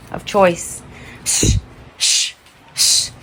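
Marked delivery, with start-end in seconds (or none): 1.3-3.1 breathy shh while searching; hushing whisper. Real, not acted. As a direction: shush nervously while creeping up. Hushing while hunting the lizard.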